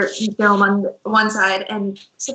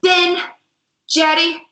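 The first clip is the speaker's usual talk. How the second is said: higher, louder calls to the back room; mildly voiced girl calling out; 7 semitones higher, 3 dB louder than usual. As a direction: yell names into the back. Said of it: "runs into the back and goes".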